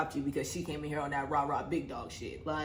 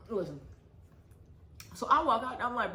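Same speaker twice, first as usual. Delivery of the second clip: usual speaking voice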